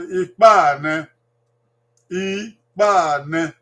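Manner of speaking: Ikpa ne stretched out slowly, syllable by syllable; slow drawn-out Edo. Shown as a demonstration. Teacher slowly drawing out Ikpa ne for parents and children. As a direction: lean on Ikpa and draw the words out. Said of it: "Ikpa, ba," you drag it".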